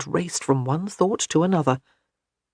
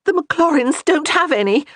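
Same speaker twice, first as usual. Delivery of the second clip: higher and louder despairing wail; 11 semitones higher, 5 dB louder than usual. A narrator's reading. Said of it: "She'd wailed out her last remark".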